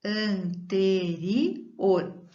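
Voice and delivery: "Anterior" very slowly with light tapped r's; very slow teaching demo; stretched weak R. Shown as a demonstration. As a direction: sound out "Anterior" syllable by syllable. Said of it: "Fraco era".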